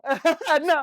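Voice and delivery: laughing out the answer. Real, not acted. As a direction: say it through a burst of laughter. Bursts out laughing at a question.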